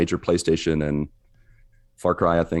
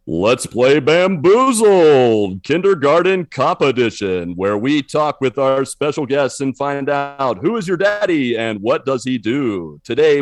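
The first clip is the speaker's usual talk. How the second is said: slow, higher and louder announcer voice; game show announcer; 6 semitones higher, 3 dB louder, slower than usual. Acted. Game show host intro.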